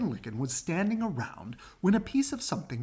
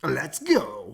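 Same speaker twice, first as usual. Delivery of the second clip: honking; 7 dB louder than usual. Acted. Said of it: "honked Goosey Lucy".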